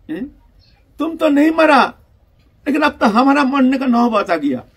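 loud, high alarmed appeal; acted desperate protest, pitch leaping up; frightened pleading. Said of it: "भय के मारे".

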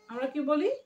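ordinary talk

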